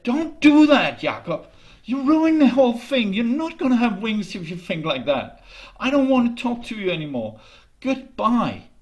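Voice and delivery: high, loud, scolding outburst; angry girl. Acted. Janie scolds Jakob and storms off, loud and angry. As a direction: snap at him like a hurt young girl. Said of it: "And then she got angry".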